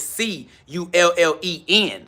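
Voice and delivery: slow letter by letter, C U L L E N; each letter of C U L L E N set apart slowly; slowly spelled. Shown as a demonstration. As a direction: spell it out patiently for the chat. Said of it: "Let me say it slow".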